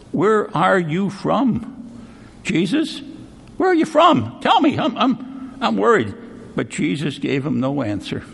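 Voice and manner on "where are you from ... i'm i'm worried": rising, anxious questioning that climbs much higher; frightened pleading. Retold or imitated. Pilate anxiously pressing Jesus where he comes from, rising panic. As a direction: press him for an answer, growing scared. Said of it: "Then Pilate was more afraid".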